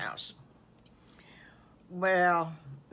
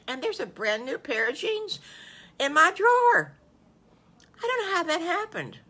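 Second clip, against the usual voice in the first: put-on high, eager child voice rising louder; anxious little wolf; 5 semitones higher, 3 dB louder than usual. Little wolf telling the smiling farmers about the jeans, high and anxious. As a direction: tumble it out like a worried boy.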